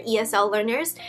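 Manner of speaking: normal talking voice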